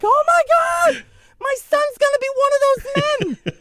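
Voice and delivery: very high laughing shout; laughing mom. Retold or imitated. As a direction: shriek it through laughter. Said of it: "my mom breaks down laughing".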